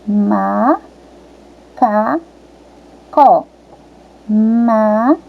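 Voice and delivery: "Macaco" as "Ma... ca... co"; three separate, held beats in "Macaco"; split into syllables. Shown as a demonstration. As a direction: sound out "Macaco" syllable by syllable with long gaps. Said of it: "o primeiro pedacinho que sai da nossa boca".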